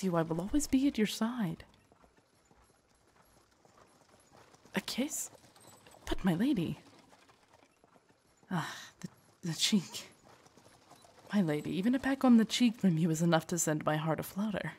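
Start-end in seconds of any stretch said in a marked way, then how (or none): 4.7-10.1 soft, halting disbelief; hushed and hesitant; flustered surprise. Acted. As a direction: stammer softly, thrown by the request. Guard asked for a kiss.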